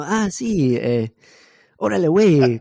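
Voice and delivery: high, loud mock Mexican accent; playful Mexican Spanish impression. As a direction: ham up a Mexican "órale, güey". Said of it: "O como mexicano".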